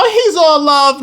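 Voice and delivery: high mocking sing-song; mocking Christians. Preacher mocking Christians who call God all love. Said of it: "disgusting Christians talking about".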